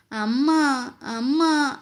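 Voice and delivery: mildly voiced child's cry for mother; tearful calling. A narrator's reading. Little boy missing his mother, mildly tearful call. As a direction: call out plaintively, drawing out the end. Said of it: "అని ఏడుస్తూ వెక్కిళ్ళు పెట్టాడు".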